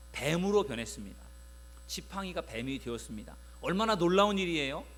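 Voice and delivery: ordinary talk, nothing marked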